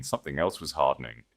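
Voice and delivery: usual speaking voice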